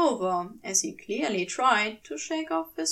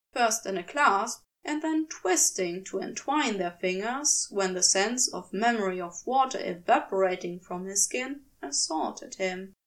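usual speaking voice

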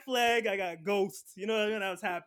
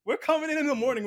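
louder, higher, insistent mock yell; defiant customers; 7 semitones higher, 7 dB louder than usual. Customers telling Best Buy it's too late, loud defiant yell. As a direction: push back like an angry crowd.

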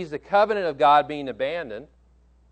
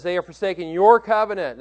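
your pressed hard and loud; stress on your; 2 semitones higher, 7 dB louder than usual. Shown as a demonstration. Preacher reading Elijah's complaint, stressing your. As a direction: lean on the word your, loud. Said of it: "And these are emphasized".

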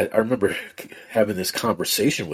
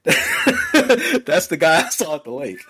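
laughing disbelief; 10 semitones higher, 3 dB louder, slower than usual. Real, not acted. Recognizing man on Zoom.

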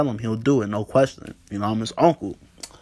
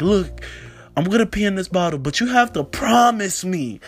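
put-on high, insistent kid laying down terms; imitated earnest demand, slowing on the condition; all serious; 7 semitones higher than usual. Nephew makes uncle promise before helping, high and serious. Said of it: "he's looking at me all serious".

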